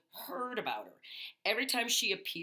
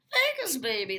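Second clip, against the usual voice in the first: excited Vegas cheer; 6 semitones higher, 8 dB louder than usual. Acted. Vegas boast.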